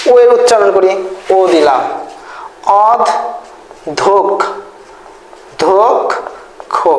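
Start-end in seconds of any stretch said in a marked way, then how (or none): 2.9-6.9 অধ, ধোক, খো with long pauses between the pieces; syllable by syllable. Shown as a demonstration. Teacher spelling out the word as pronounced, syllable by syllable. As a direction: break the word into slow, separate sound chunks. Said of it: "দেখেন যেভাবে উচ্চারণ করছি".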